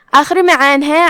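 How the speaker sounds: normal talking voice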